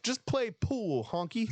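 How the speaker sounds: imitating the movie insult; movie character voice. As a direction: wave him off in a put-on voice. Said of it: "He's like".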